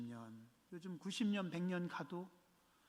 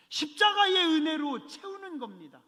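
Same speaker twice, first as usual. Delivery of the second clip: forceful preaching; 10 semitones higher, 6 dB louder than usual. Real, not acted. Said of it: "repeated phrase preached up".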